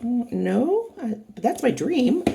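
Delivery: bright, high mock confession of a dream; playful high-pitched confession; dreamy reply. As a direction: gush about the dream with a grin. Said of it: "And it's like".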